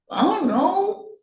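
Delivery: put-on high, swooping kid's shrug; sulky child. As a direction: answer like a boy who can't be bothered. Sulky son dodges mother's question.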